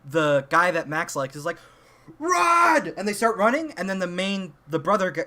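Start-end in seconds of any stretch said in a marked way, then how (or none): 2.2-2.9 high, loud yell to flee; urgent shout. Retold or imitated. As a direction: scream it so they bolt. Guy warns friends of killer.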